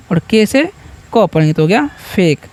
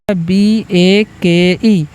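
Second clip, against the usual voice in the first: spelled out slowly; much slower than usual. Shown as a demonstration. Spelling out letters for students.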